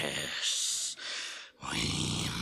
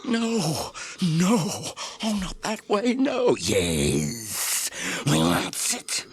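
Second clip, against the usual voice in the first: high, loud desperate wail; frantic creature shriek; 10 semitones higher, 8 dB louder than usual. Acted. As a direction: shriek it out as if the precious is slipping away. Gollum cries out in protest.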